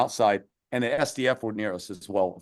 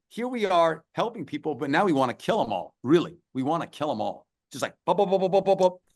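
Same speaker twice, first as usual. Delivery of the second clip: usual speaking voice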